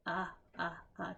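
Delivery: repeated uh, uh, uh; short U sound. Shown as a demonstration. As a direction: sound out the short U three times. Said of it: "That is the short U sound".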